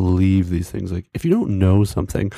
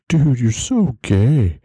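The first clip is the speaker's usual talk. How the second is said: mock teasing jeer; 5 semitones higher, much slower than usual. Guys insulting a friend in jest, mock teasing. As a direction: rib a buddy with a drawn-out jab.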